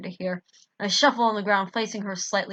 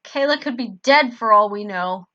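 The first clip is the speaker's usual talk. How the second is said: snapping; 8 dB louder than usual. A narrator's reading. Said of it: "he snapped".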